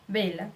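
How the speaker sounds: vela with a Spanish B sound, "bela". Shown as a demonstration. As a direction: start vela on a B, lips together. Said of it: "ele vai ser pronunciado como B". (V com som de B em vela)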